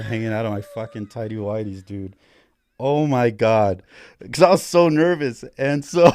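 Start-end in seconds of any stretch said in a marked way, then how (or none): 2.8-6.1 excited. Real, not acted. Laughing at own embarrassing story.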